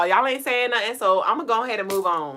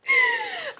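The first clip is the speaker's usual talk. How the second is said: sudden high giggle; laughing. Real, not acted. Reacting to chat comment.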